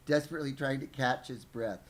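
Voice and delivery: ordinary delivery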